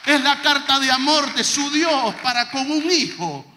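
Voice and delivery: loud, slow preacher's build; fiery preaching. Acted. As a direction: preach it to the back row, swelling. (predicación apasionada y fuerte)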